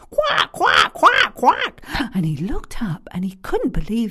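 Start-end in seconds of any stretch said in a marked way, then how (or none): 0.1-1.8 loud high squawking quack quack; terrible bird noise. Acted. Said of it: "he heard a terrible noise".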